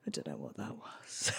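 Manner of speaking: very low, hushed mumble; flustered near-whisper, played for laughs. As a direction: imitate him sheepish, barely audible. Sheepish man returns flustered.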